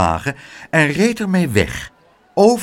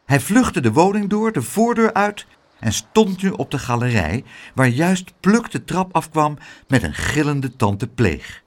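usual speaking voice

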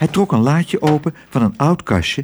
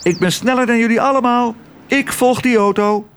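high, quick bird voice; swallow calling out; 11 semitones higher than usual. A narrator's reading. Swallow sets off after the ambulance, high boastful calling. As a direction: call it out high and eager. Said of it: "riep de zwaluw".

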